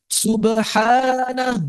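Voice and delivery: "Subhanah" sung out high and drawn out slowly. Shown as a demonstration. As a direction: lift "Subhanah" high and stretch it long. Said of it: "Subhanahnya tinggi".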